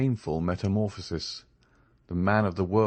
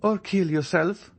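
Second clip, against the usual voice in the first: much higher, cold ruthless urging; vestal urging the gladiator; 11 semitones higher than usual. A narrator's reading. Eugénie tells Andrea to end his life, ferocious and proud. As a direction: demand he finish it, pitiless. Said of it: "in a tone which a vestal".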